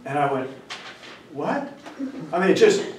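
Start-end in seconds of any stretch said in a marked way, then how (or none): 1.3-1.7 soft, higher-pitched baffled "what?"; taken aback. Retold or imitated. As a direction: react quietly, caught off guard. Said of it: "totally caught me off guard".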